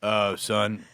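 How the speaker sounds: lightly voiced dad, a little low; hint of a father starting the talk; dad's talk. Son acting out his dad's talk, lightly voiced dad. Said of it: "the talk my dad gave me".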